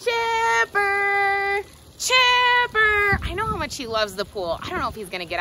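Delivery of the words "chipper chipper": high, ringing call across the yard; sing-song name call; calling the dog. Real, not acted. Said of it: "can you guys yell for Chipper with me".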